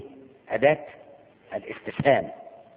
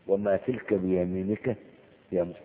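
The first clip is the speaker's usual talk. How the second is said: slow Quranic recitation; 4 semitones lower than usual. Acted. Recites verse slowly.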